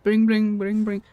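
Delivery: loud bring bring ringtone; phone ringing imitation.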